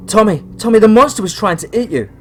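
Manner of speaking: very high, loud and rushed; little brother blurting out about the monster; hyper. Acted. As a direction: tumble out the words like an overexcited kid. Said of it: "he said, all hyper".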